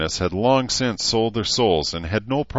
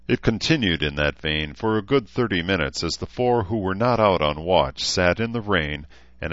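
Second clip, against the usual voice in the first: ordinary delivery, nothing marked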